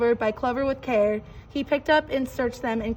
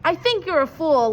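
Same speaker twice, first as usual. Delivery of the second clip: laughing; 4 semitones higher, 6 dB louder than usual. A narrator's reading. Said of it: "laughed the sour kangaroo".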